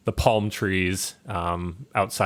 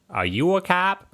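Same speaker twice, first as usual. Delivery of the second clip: put-on Boston accent; 9 semitones higher than usual. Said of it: "Trying to channel Matt Damon".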